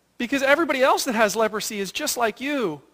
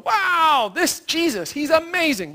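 higher, impressed exclamation; wowed crowd; 7 semitones higher than usual. Retold or imitated. Everybody retelling the healing, wowed and excited. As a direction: play the crowd in awe.

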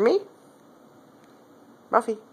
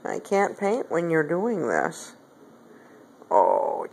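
ordinary talk

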